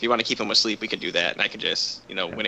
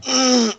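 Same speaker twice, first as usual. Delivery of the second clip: high, loud groan of effort; pained groan; 12 semitones higher, 8 dB louder than usual. Acted. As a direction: groan as if your whole body aches. Character sore and forced up.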